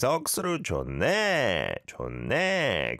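네 pushed high, then falling; big upward swoop on each 네; Busan intonation. Shown as a demonstration. Fixing the intonation like a real Busanite, 네 going up. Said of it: "Go up at the end to sound like a real Busanite".

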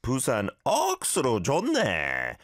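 fast, punchy Busan dialect exclamation; Busan accent. Shown as a demonstration. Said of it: "we're gonna do it with a Busan satori".

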